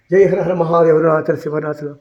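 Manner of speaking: loud call of Har Har Mahadev; fervent shouted invocation; devotional cry. Real, not acted. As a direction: call out to Shiva with all your heart.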